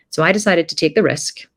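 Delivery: ordinary delivery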